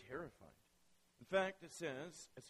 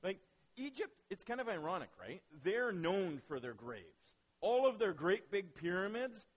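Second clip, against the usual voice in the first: usual speaking voice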